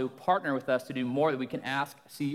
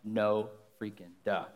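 deadpan sarcasm; 7 semitones lower, 5 dB quieter, slower than usual. Retold or imitated. Sarcastic hushed reply.

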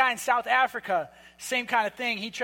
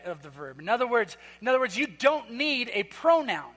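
usual speaking voice

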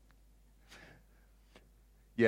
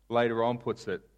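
ordinary delivery

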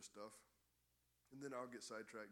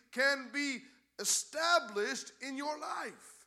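loud, higher preaching voice; 9 semitones higher, 12 dB louder than usual. Real, not acted. Pastor urging his church toward Jesus, loud and rising.

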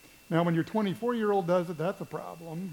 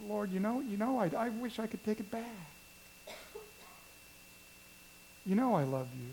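remorseful; 10 dB quieter, faster than usual. Acted. Peter's remorseful confession.

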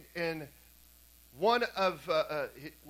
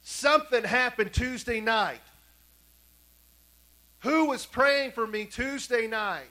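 loud, high, slow retold demand; imitating a daughter's raised, urgent plea; insistent asking; 5 semitones higher, 7 dB louder, slower than usual. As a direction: draw out each word, pressing hard for an answer. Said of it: "she keeps on asking him this".

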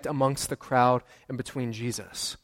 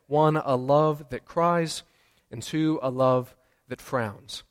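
slow deliberate points; slower than usual. Shown as a demonstration. Announcing sermon points slowly.

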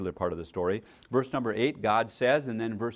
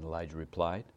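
usual speaking voice

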